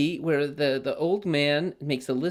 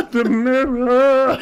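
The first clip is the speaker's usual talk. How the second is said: much higher, louder, very slow Muppet squeal; 12 semitones higher, 12 dB louder, much slower than usual. Retold or imitated. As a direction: stretch it out like a puppet. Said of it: "You gotta get a little Muppet in there".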